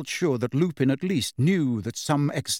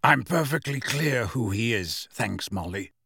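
cold. A narrator's reading. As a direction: answer coldly, dropping away on the thanks. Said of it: "said Sirius coldly".

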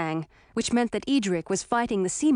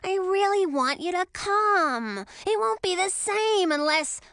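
higher, slow pleading whine; whining young dragon; 9 semitones higher than usual. A narrator's reading. Young dragon begs Emma to come, whiny. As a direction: beg like a disappointed kid. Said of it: "Ralph whined".

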